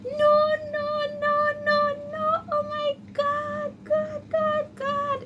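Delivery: very high crying no-no-no, wailing to God; high wailing sob. Retold or imitated. Student finding the assignment overdue, wailing high and sobbing. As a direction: wail it out high like sobbing in despair. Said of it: "I started screaming like this".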